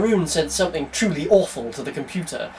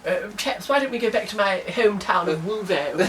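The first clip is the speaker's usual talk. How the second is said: a hint of Wolverhampton, higher; Wolverhampton accent; 4 semitones higher, 3 dB quieter than usual. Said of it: "he's from Wolverhampton, so that's why he sounds like this".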